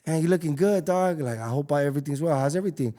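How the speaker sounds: high-pitched, warm, buddy-buddy greeting; friendly homie greeting. Retold or imitated. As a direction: play it up like a long-lost friend. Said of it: "You come at someone like".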